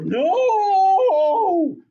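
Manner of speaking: high, loud exclaimed no; emphatic no. Acted. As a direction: cut them off with a big No. Teacher mock-correcting students.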